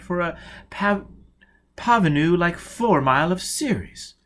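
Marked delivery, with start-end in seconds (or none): none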